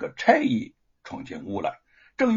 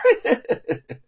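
short gloating villain laugh, high and loud; villain's laugh; 11 semitones higher, 7 dB louder than usual. A narrator's reading. Villain laughing at his captives, gloating cackle. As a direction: cackle over your victims.

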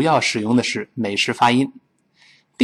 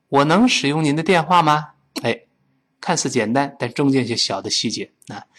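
ordinary talk

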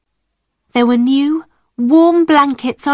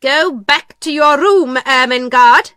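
stern, raised, commanding voice; cold; 3 semitones higher, 5 dB louder than usual. A narrator's reading. Miss Minchin sending Ermengarde to her room, cold. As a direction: dismiss her with cold authority. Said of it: "Miss Minchin said coldly".

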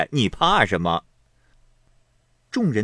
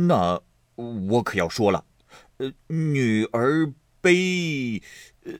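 halting stall; 2 semitones higher than usual. A narrator's reading. Xue Pan stuck on his drinking-game verse, halting. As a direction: stretch each word while groping for a rhyme. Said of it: "说了半日，不见说底下的".

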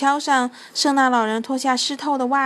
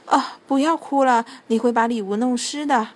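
ordinary delivery, nothing marked

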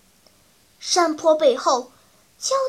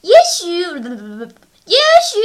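eager interjecting; 3 semitones higher, 6 dB louder, much faster than usual. A narrator's reading. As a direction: cry out eagerly to get another theory in. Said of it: "迫不及待地插嘴".

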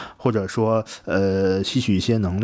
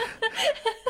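high squealing laugh; 10 dB quieter than usual. Real, not acted.

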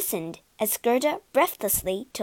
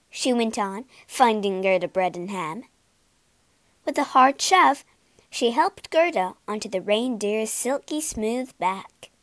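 usual speaking voice

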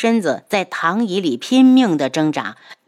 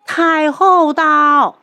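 high, slow, loud eunuch announcement; shrill palace servant; 9 semitones higher, 6 dB louder, much slower than usual. A narrator's reading. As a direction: draw out the call like a court herald. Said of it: "太监的声音在外面传进来".

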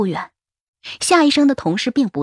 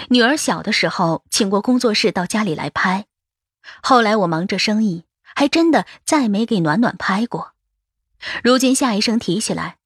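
ordinary delivery, nothing marked

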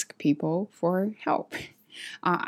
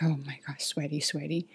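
embarrassed mutter; 2 semitones lower, 8 dB quieter than usual. Retold or imitated. Seeing the man approach while sweaty, soft embarrassed mutter. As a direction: mutter under your breath, flustered.

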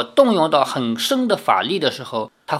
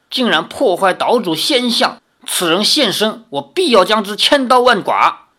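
rising, louder, higher threat to the end; furious vow; 6 semitones higher, 4 dB louder than usual. A narrator's reading. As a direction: build to a vengeful outburst. Grandmother vows revenge.

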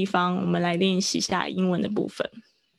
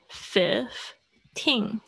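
put-on "fifteen" broken slowly into "fif" and "teen"; sounded out; 4 dB quieter than usual. Shown as a demonstration. Teacher writing it out for class, slow split "fifteen". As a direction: say "fif", long pause, then "teen". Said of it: "我把它就是拼出来".

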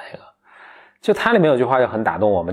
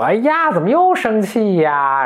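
higher, slow, stagey coaxing; theatrical daughter voice; 8 semitones higher, slower than usual. Retold or imitated. Retelling a cartoon daughter coaxing her father, stagey and slow. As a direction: ham up the demon's daughter like a stage actress. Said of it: "你这是北京人艺版吗".

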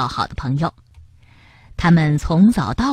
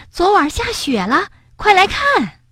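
much higher, excited call; excited urging; 12 semitones higher than usual. Acted. Rabbit urging the sleepy frog to come see the snow. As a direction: hurry your sleepy friend outside.

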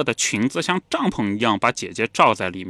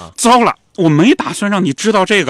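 高而响，慌忙收口; 一下子意识到说漏了嘴; 说漏嘴的惊呼; 7 semitones higher, 8 dB louder than usual. Retold or imitated. 姐姐说漏嘴后惊慌. (姐姐说出孩子父亲后，惊慌地大声懊悔)

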